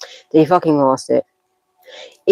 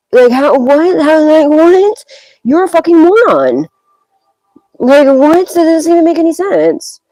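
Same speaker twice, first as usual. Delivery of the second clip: much higher and louder, disbelieving; exasperated outburst; 11 semitones higher, 5 dB louder than usual. Real, not acted. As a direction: blow up in disbelief at their stupidity. Ranting at the sender's stupidity.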